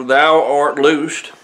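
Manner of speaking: each word drawn out slowly and weighty; slow proclamation. Retold or imitated. Preacher voicing Jesus to the bent woman, slow and weighty. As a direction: proclaim it slowly, like a command that heals.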